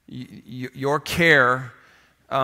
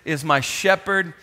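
slow, weighty scripture recitation with the room; leading a church in unison reading; slow congregational recital; 4 semitones higher, 4 dB louder, slower than usual. Real, not acted. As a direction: read it out together, slow and firm. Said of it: "could you say it with me?".

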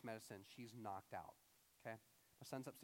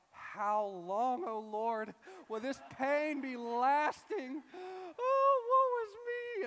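loud, drawn-out wail rising to a high cry; hammed-up woe-is-me sobbing; mock lament; 12 semitones higher, 14 dB louder, much slower than usual. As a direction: wail to heaven like a suffering prophet. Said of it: "I just move into this lamenting moment".

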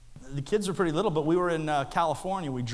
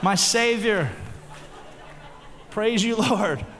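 louder, fervent thanks to Jesus; lost driver loudly praising God on seeing the exit sign; relieved praying in tongues; 5 semitones higher, 6 dB louder, slower than usual. Retold or imitated. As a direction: pour it out like a joyful prayer of relief. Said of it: "I started speaking in tongues when I saw it".

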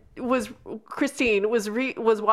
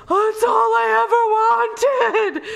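much higher and louder, a gushing, teary, laughing swoon; swooning romance fan; 11 semitones higher, 5 dB louder than usual. Retold or imitated. Mocking romance-novel girls with a high, gushing, tearful swoon. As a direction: overplay the starry-eyed heroine, squealing. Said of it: "romance girls are like".

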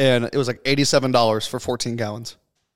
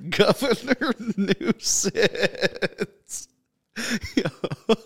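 high, slow words broken by laughing; helpless laughter; 7 semitones higher, 4 dB quieter, much slower than usual. Real, not acted. Host repeats the nickname Governor Nuisance, helplessly laughing. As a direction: squeeze the words out through real laughter.

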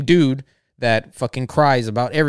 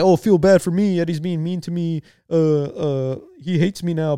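higher, put-on poor-me voice; mock self-pity; 5 semitones higher than usual. Drunk guy begging everyone to feel bad, mock self-pity. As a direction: imitate someone fishing for sympathy, hammed-up. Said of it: "trying to have pity parties".

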